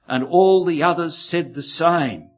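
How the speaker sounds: ordinary delivery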